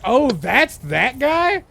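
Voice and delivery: imitating his own loud, stretched disbelief; retold surprise. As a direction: act out slow-dawning surprise. Retells his own surprised reaction.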